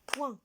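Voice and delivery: soft syllable demo point; isolated syllable. Shown as a demonstration. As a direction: model point with nasal oin. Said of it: "Deuxième syllabe".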